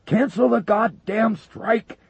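very high, loud, slow yell; desperate yell. Acted. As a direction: scream at them to stop. Wilkinson keeps yelling.

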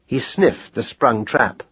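plain reading voice

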